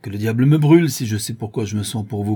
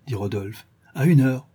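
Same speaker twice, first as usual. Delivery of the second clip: ordinary delivery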